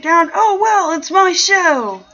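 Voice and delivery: high, loud, drawn-out brush-off; playful defiance. Pretend. Host dismissing complaints about the music, loud playful defiance. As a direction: sing it out high and loud, unapologetic.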